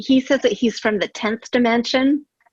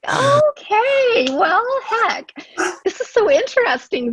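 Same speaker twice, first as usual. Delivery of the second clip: much higher, bright excited squeal; squealing excitement; 14 semitones higher, slower than usual. Acted. Excited squeal to her friend about the mysterious soul formula. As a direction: gush like you just heard thrilling news.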